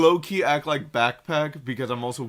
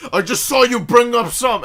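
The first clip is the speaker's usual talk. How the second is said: loud, high accusation; mock-angry raised voice; imitated yelling dad; 10 semitones higher, 10 dB louder than usual. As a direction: act out the father hurling an accusation. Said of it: "like, a yelling match at each other".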